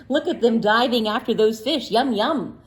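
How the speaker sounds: ordinary delivery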